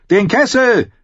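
high, loud chant of many voices; bellowing crowd. A narrator's reading. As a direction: roar it out like a whole hall. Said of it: "brüllten sie wie aus einem Mund".

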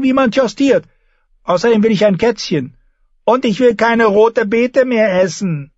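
put-on high-pitched, insistent child; defiant boy king. A narrator's reading. Boy king lists his orders to his mother, high and defiant. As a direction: pile up demands in a child's tantrum. Voices the boy king's tantrum.

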